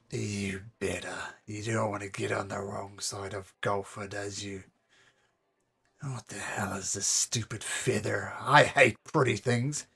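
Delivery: low, quiet threatening thug; menacing thug. Acted. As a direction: lean in and demand the money softly. Golfer voice again.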